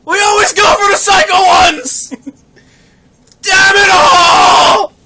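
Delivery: high, loud comic wail; hammed-up cry of despair; anguished shouting. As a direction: bellow it in mock anguish. Acts out wailing to Dad.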